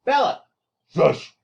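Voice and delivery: real sharp, high, loud shout; scolding the dog. Real, not acted. Teacher interrupting the lesson to shush a barking dog, scolding. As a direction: call out to hush the dog.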